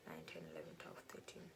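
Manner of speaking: counting in a whisper. Real, not acted. Vlogger working out ages, whispered counting. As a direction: count it under your breath. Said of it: "counting under her breath".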